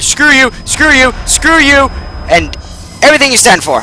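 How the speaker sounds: high, loud furious yelling; angry shouting. Real, not acted. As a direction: scream defiance at the monster. Yelling at pursuing monster.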